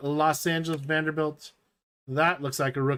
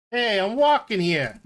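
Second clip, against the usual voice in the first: put-on brash New York cabbie accent, louder; hammed-up New York street accent; 4 semitones higher, 4 dB louder than usual. Doing a New York accent for the card viewers, brash. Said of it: "if you want to do a New York accent".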